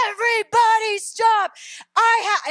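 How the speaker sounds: loud, high imitated gunman's yell; retold scream. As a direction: yell it out like a gunman taking over a room. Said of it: "and he screams".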